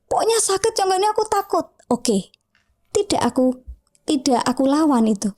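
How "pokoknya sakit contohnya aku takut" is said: high-pitched imitation of a scared kid; frightened child. Mario answers about the injection, imitated frightened child. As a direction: play the little boy protesting, pitched well up. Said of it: "Dia jadi yang".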